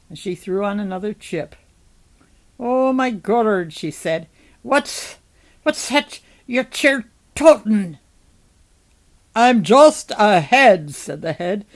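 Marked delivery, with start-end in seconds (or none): 2.6-3.7 higher, louder cry of astonishment; startled exclamation. A narrator's reading. July startled by the head. 4.6-8.0 high, loud, halting question; astonished rustic character voice, pitched up; startled question. A narrator's reading. July startled by the head. 9.4-11.0 loud, slow, stretched-out declaration; booming slow character line; head announcing itself. A narrator's reading. Severed head introduces itself aloud.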